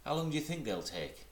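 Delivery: high, eager small kangaroo; little Roo voice. Acted. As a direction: chatter up high like a tiny child.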